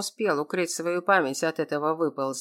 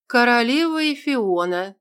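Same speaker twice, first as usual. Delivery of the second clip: higher, grand, ceremonial title; solemn announcement; 5 semitones higher, 4 dB louder than usual. A narrator's reading. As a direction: proclaim it like a herald. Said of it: "торжественно произнесла я".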